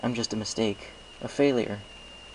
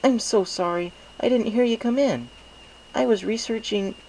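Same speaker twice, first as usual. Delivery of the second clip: put-on higher, eager young scholar mare; Twilight Sparkle apologetic; 13 semitones higher, 4 dB louder than usual. A narrator's reading. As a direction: apologize quickly, a bit flustered. Twilight voiced in story.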